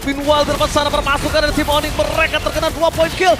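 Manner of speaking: loud hype play-by-play call; fighting game announcer. Acted. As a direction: shout the action like an esports caster. Caster hypes triple kill.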